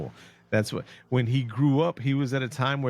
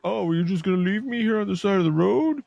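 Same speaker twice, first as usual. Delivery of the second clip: higher, louder mock guilt-trip whine; whiny Karen; 6 semitones higher, 3 dB louder than usual. Stranded woman begs the driver not to leave, mock whiny Karen voice. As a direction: play the stranded woman guilt-tripping him. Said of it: "she was like a Karen".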